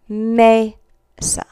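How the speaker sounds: mesa split slowly into may, then sah; long A. Shown as a demonstration. Teacher breaking down the example word for students, long A. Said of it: "this sounds like the long A".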